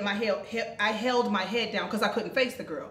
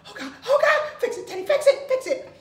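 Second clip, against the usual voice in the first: high, loud panicked cry of oh God; frantic begging, half laughing; panicked shriek; 14 semitones higher, 5 dB louder than usual. Retold or imitated. Mother spotting her daughter on the stairs, panicked high shriek. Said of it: "I'm just like".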